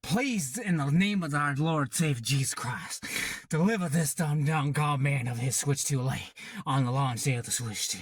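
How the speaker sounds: put-on Southern preacher-style plea; southern accent. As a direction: plead in a mock Southern accent. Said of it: "put in that southern accent".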